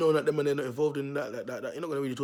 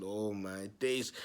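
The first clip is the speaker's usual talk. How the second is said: dismayed; 6 semitones lower, 4 dB quieter, much slower than usual. Retold or imitated. Seeing the gang arrive.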